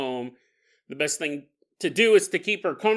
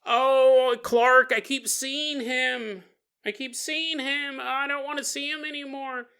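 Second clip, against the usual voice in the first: high, slow, moaning lament; mock distressed whine; 9 semitones higher, slower than usual. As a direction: imitate a frightened woman pleading, drawn out. Acting Nancy troubled by seeing Clark.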